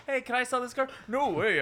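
high-pitched mock salesman dub; car dealership voice. Friend dubbing both sides of a car deal, high put-on voice. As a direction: pitch it way up for a silly one-man dub. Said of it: "that's my car dealership voice".

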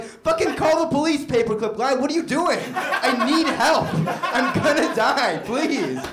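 loud, high-pitched exasperated begging; mock frantic plea. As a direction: beg for rescue in comic desperation. Acted plea to paperclip helper.

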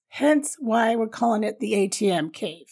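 ordinary talk

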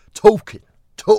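"talking, tool" shouted high and slow, vowel rounded into a W; stretched W sound. Shown as a demonstration. Correcting co-host's accent, "talking, tool" loud with drawn-out W. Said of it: "there's a W there".

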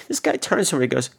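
ordinary talk

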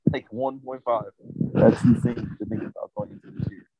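muffled, far-off mic sound; muffled underwater. Real, not acted. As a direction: voice muffled by a hand over the mic. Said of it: "you sound like you're underwater right now".

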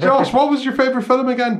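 very high sing-out call; mock calling out. Host pretending to call lost Josh, mock calling out. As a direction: shout a chatty question into the woods, hammed up. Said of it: "That's what I would be doing".

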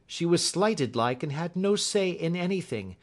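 high-pitched servant woman's tale; Mrs Toller, earnest. Acted. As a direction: give your testimony, pitched high. Voices Mrs Toller in story.

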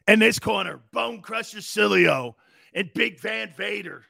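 wrestling announcer introducing a tag team; mock fight-night intro voice; ring announcer.

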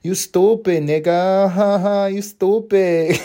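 drawn-out, flirty mock giggle.

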